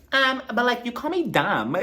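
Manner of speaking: high, giggly meek voice; imitating Mia. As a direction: play it small and hurt. Imitating Mia's timid comeback.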